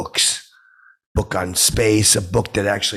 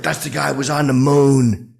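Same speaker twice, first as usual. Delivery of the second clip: higher, louder mock yell; retold yelling; 6 semitones higher, 5 dB louder than usual. Diner yelling at astronaut in restaurant, mock yell. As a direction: yell it out in a retelling, pitched up. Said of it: "I started yelling at him".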